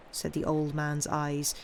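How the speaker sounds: usual speaking voice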